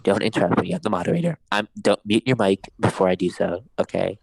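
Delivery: deep mock-stern moderator. Keeps mocking Diego as moderator.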